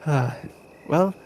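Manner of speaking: normal talking voice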